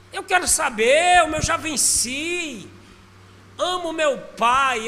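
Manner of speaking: high, loud, slow proclamation; fervent preaching. Real, not acted. Preacher proclaiming his love for his father, loud and slow. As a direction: drive it home from the pulpit.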